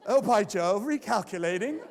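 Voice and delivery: loud, hammed-up British accent GPS; a posh British navigator in mock. Teller jokes the GPS with British accent, hammed-up British. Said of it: "now you try a British accent".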